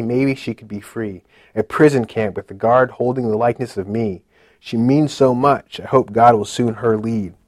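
a heavy, deliberate reading of a confession poem; poem recitation. Acted. Recites his own poem.

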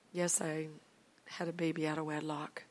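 low, very soft admission; retold shamefaced murmur; ashamed confession. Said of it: "I'm so ashamed".

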